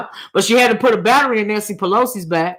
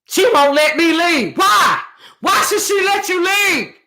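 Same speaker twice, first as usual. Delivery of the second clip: heated outburst; 6 semitones higher, 3 dB louder than usual. Real, not acted. Heated reaction to a comment.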